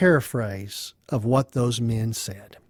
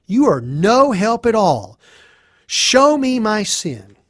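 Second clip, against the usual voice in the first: higher, forceful rebuke; indignant challenge; 6 semitones higher, 4 dB louder than usual. Acted. Job rebuking Eliphaz and demanding proof, indignant. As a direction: challenge the accuser with a raised, emphatic voice.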